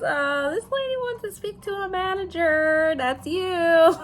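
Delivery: high-pitched imitation of a coworker; employee passing it on. Employee telling her manager a lady wants her, high-pitched imitation. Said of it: "she comes back, she goes".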